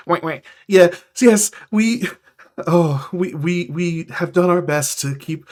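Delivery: excited, stop-start reply; eager celestial. Acted. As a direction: fall over your words eagerly. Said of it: "nods very quickly and goes".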